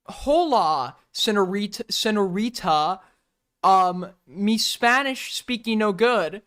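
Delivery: slow, hammed-up fake Spanish accent; mocking broken Spanish accent. Imitating a dude addressing someone's mom, clumsy fake Spanish accent. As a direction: stretch it out in halting mock-Spanish accent. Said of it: "She spoke Spanish, and he's like".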